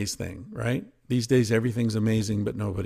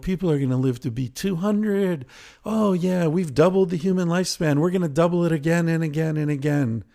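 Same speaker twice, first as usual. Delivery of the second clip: higher, fast, gushing sales-pitch parody; mock hype; 7 semitones higher than usual. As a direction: oversell the promise, tongue in cheek. Mocking promises of progress.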